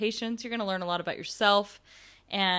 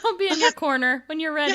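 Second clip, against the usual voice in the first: laughing; 8 semitones higher, 6 dB louder than usual. Pretend. Friend laughing, joins the bit.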